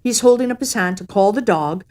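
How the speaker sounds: plain reading voice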